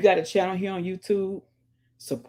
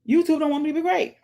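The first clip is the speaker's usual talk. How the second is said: high, fast mock outrage; playful high protest; mock complaint; 11 semitones higher, 4 dB louder, faster than usual. Real, not acted. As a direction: jokingly whine about YouTube. Joking mock complaint about YouTube.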